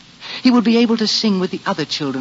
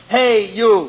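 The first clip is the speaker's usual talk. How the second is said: high-pitched summoning shout; careless shouting; 6 semitones higher than usual. A narrator's reading. Inn folk call the boy to an errand, careless shout. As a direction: holler for the errand boy, rising on the repeat. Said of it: "way they all had of shouting".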